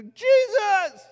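high, very loud cry for help; panicked shout. Retold or imitated. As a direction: yell his name as the boat sinks.

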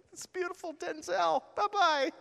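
very high, squeaky crying voice; mock tearful. Moviegoer weeping at a death scene, hammed-up tearful squeak. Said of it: "uh, that I cry".

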